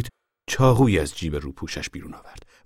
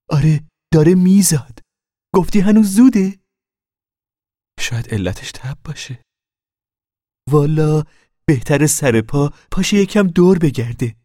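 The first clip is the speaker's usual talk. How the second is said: a lightly voiced brisk older neighbour, higher and louder; voiced Mrs Wainwright; 9 semitones higher, 8 dB louder than usual. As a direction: press the girl for an answer like a no-nonsense midwife. Mrs Wainwright declares birth coming.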